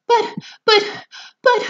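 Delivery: very high loud stammering shriek. Acted. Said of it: "shrieked Missus Salt".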